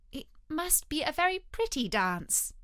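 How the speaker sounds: put-on high, gentle little-girl politeness; shy, tentative compliment; timid. A narrator's reading. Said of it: "said Alice timidly".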